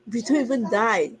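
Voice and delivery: ordinary talk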